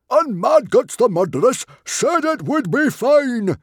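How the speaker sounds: high, very loud protest; outraged yell. A narrator's reading. Stoic blaming Mad Guts for the defeat, outraged yell. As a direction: yell it in indignant disbelief. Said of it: "yelled Stoic".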